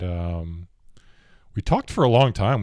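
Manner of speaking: ordinary talk, nothing marked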